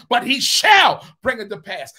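"shall" hit hard and loud; stress on shall. Shown as a demonstration. Pastor contrasting may with shall, stress on "shall". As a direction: punch "shall" against may and possibly. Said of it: "Not he may bring it to pass".

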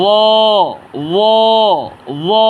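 ও full and rounded, tongue at teeth; thick, heavy. Shown as a demonstration. Teacher models a letter for learners, thick and heavy. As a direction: give ও a heavy, weighty sound. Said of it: "মোটা আওয়াজে উচ্চারণ করব".

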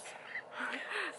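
embarrassed little giggle; self-conscious laugh. Real, not acted. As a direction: laugh it off shyly. Laugh at own awkward intro.

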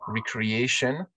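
ordinary talk

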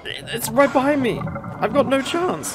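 high panicked cries of despair; panicking gamer. Real, not acted. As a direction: panic as they close in. Chased by two monsters.

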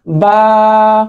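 बा with both lips pressed together; lips closed ba. Shown as a demonstration. Teacher shows students how to say बा. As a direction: model बा, lips meeting firmly. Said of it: "दोनों होंठ आपस में मिलेंगे".

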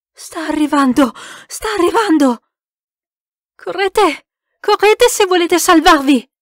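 frantic high-pitched alarm; shouted warning. A narrator's reading. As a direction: yell for everyone to run. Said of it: "gridò il francese".

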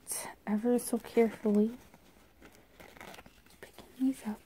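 hushed whisper. Real, not acted. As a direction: whisper gently while handling cards. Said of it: "I'm being real gentle".

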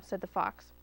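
usual speaking voice

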